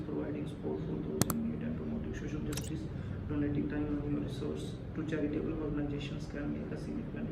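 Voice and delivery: soft, slow, careful reading aloud; hushed test read of the passage; quiet slow practice read. Shown as a demonstration. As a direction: read it low and slow like a first try. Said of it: "practice करके बताता हूँ".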